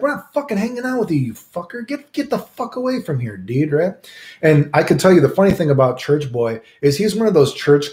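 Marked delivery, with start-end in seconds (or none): 0.0-3.7 much higher, fast exasperated yell; mild mock anger. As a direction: chase him off. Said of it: "I'm like".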